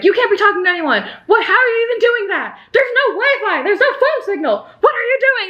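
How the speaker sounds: high, fast-rising mock outrage with laughter in it; storming in. Podcaster acting out the man storming in, high-pitched mock outrage. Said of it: "Ivan storms in and is like".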